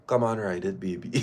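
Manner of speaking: a hushed, teasing come-on; mock bedroom voice. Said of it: "look me dead in my face and ball".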